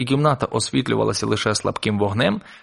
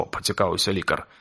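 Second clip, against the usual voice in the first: plain reading voice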